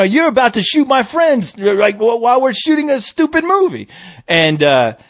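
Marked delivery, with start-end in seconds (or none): 0.0-3.9 high-pitched, exasperated retold complaint; indignant protest.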